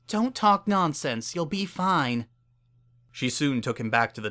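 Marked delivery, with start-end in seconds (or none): 0.1-2.3 higher, gentle mare voice urging him on; a caring mare coaxing a stranger; kind Earth Pony mayor. A narrator's reading. Earth Pony mayor comforting the dying stranger, kindly higher voice.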